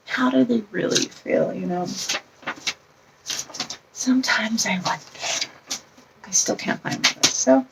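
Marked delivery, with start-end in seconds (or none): none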